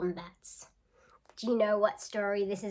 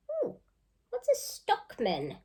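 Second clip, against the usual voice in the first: ordinary talk, nothing marked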